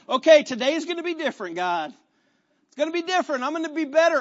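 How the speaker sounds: high, pumped-up promise; acted resolve. Believer promises God a better day, acted resolve. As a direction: declare it like an eager fresh start.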